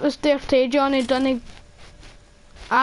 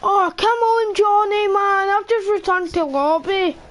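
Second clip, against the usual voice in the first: frustrated; 7 semitones higher than usual. Real, not acted. Frustrated after dying.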